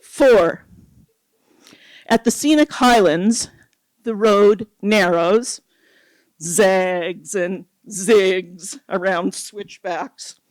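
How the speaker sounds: measured, dramatic poetry recital; poem reading. Acted. Reads her poem aloud.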